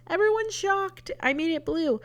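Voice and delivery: high, hammed-up mock shock; mock dramatic. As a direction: play it like scandalous news. Mock announcing a shocking choice.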